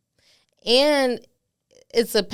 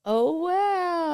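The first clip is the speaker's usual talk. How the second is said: high, drawn-out oh wow; real awed surprise, high-pitched; touched amazement; 11 semitones higher than usual. Real, not acted. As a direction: marvel at it, pitch soaring. Reacts to touching story.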